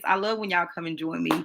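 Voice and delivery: ordinary talk, nothing marked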